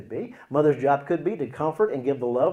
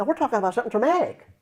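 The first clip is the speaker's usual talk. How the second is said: higher, fast, insistent; emphatic retelling; 10 semitones higher, 4 dB louder, faster than usual. Retold or imitated. Counselor to person dozing off mid-session, high and emphatic. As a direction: pitch it up as if to rouse a dozer.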